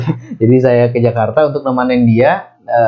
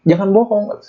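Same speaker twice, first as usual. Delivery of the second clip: high, tearful plea; tearful denial; 8 semitones higher than usual. Retold or imitated. He pleads with the brother, high and crying. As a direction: cry out in disbelief. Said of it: "Sambil saya nangis".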